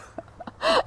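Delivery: sudden high giggle; high burst of laughter. Real, not acted. Laughing at her own lost pages, high giggle. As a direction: laugh off the blunder.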